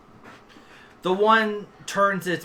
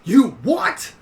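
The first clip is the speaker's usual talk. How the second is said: high, loud yelp; loud incredulous shout; 7 semitones higher, 9 dB louder than usual. Acted. Gammon Gall to a whispering companion, shouting. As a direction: yell it back in disbelief.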